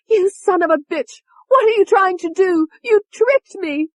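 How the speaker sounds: very high, loud, slowing on the last words; Tony screaming. A narrator's reading. Tony turning on David for tricking her, shrieking. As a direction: shriek betrayal at him. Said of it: "Tony screamed".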